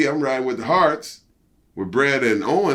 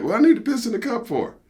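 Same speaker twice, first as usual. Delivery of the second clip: high, indignant question; a high, put-upon what-for; squeaky retold outrage at being asked; incredulous protest; 12 semitones higher than usual.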